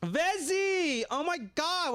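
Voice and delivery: high, excited call of a name; excited greeting. Real, not acted. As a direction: greet a friend with delight. Greeting a friend on stream.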